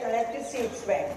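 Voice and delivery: ordinary delivery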